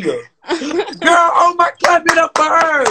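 high, loud wailing praise; hammed-up tearful church shout; mock church lady.

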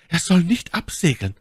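high, loud outburst; My Lady crying out. A narrator's reading. Lady forgetting her composure, high loud cry. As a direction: exclaim in a forceful high lady voice. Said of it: "rief My Lady und vergaß".

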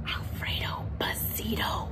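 hushed, breathless whisper. Acted. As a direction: whisper it like a frightened crowd. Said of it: "whispered the crowd".

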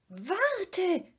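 high, loud call; cry out. A narrator's reading. Sniff calling after the running Mumin, high and loud. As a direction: shout after him in fear. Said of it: "schrie das Schnüfferl".

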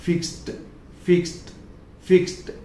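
फिक्स्ड ending in a clear t sound; fixed with t. Shown as a demonstration. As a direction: say फिक्स्ड with a hard t at the end. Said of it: "Hindi में मैंने clearly लिखा है फिक्स्ड". (फिक्स्ड का अंत ट से)

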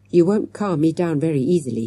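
ordinary delivery, nothing marked

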